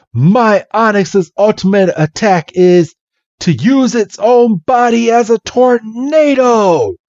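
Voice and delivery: high, building proclamation; boastful battle cry. Retold or imitated. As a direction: declare the special move like a cartoon rival. Brock proclaims his ultimate attack.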